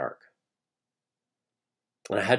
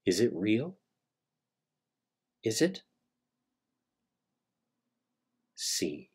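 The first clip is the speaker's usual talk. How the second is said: hushed suspense narration; 7 dB quieter than usual. A narrator's reading. Storyteller opening a monster tale to his audience, hushed and eerie. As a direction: tell it like a campfire ghost story, barely above a murmur.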